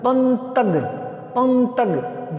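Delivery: पंतग said with the nasal before the t, as पन-तग, drawn out slowly at the end; teacher sounds out पंतग syllable by syllable, nasal first; wrong pronunciation demo. Shown as a demonstration. Teacher showing students how a misplaced dot misreads पतंग as पंतग. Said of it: "इसका उच्चारण हो जाएगा पंतग".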